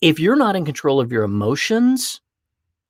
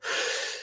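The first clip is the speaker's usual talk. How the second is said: deep breath demo; 12 dB quieter than usual. Shown as a demonstration. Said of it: "You go".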